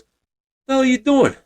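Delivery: high, incredulous mock-gruff dad bark; gruff Italian dad. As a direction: play the girlfriend's dad catching you in boxers. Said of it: "this gruff, old-school Italian guy".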